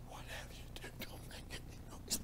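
very soft, secretive whisper; imitating mother's hushed warning; frightened mother's whisper. Mother hushing children past stepfather's room.

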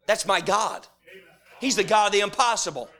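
loud, driving preacher cadence; full-force preaching. Acted. Preacher rousing his congregation, loud full-force preaching.

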